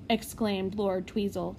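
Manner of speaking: ordinary delivery, nothing marked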